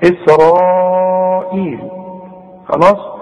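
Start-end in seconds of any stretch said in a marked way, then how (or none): 0.0-1.8 إسرائيل with the long vowel held for four counts; إسرائيل stretched slowly, four counts; long madd. Shown as a demonstration. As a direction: draw out the aa in إسرائيل. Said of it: "لازم تمدها أربع حركات".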